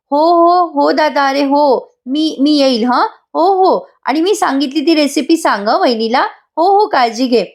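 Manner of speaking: high, bright pretend phone chatter; fake phone chat. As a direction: hams up a casual call to fool her husband. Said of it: "असं बोलायचं नाटक करते फोनवर". (फोनवर खोटं खोटं उंच आवाजात बोलणं)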